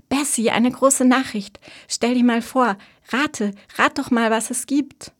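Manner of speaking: high, loud burst of big news; excited. A narrator's reading. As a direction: bubble over, urging your sister to guess. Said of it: "Marys Stimme war aufgeregt".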